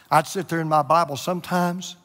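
ordinary talk

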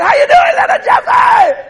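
high, loud, drawn-out hail; hollered greeting. Retold or imitated. Grandfather greeting his grandson from afar, hollering. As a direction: call out heartily to a little one.

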